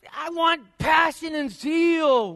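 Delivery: high, drawn-out preacher's shout; impassioned cry. Real, not acted. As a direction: cry out for fervor, stretching the words. Preacher demands zeal after droning hymn.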